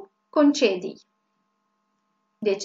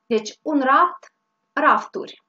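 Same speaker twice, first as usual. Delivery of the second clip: ordinary talk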